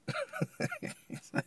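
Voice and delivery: breathy, high chuckle; real laugh. Real, not acted. Amused by Gideon flipping the fleece test, soft laughing. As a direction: laugh softly at your own retelling.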